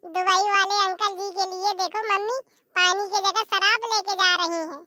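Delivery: put-on high, kid-like tattling to mummy; a little girl gleefully telling on her mother; mischievous child voice.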